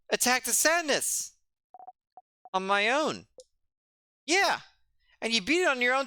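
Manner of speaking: put-on a kid demanding why his friend fell on purpose; upset young friend.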